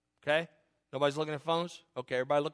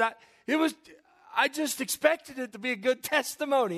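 higher-pitched, faltering excuse; retold defensiveness; 6 semitones higher than usual. Pastor excusing his amen to his wife, defensive. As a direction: replay a flustered self-defense, pitched up.

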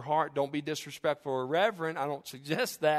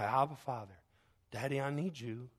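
soft plea; 2 semitones lower, 7 dB quieter than usual. Acted. Frightened believer calling on God as Daddy, soft plea. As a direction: call softly for help. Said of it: "we can just cry".

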